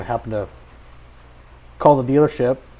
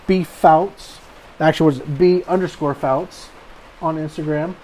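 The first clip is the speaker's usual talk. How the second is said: podcast outro; 2 semitones higher, 3 dB louder than usual. Acted. Host reads podcast outro.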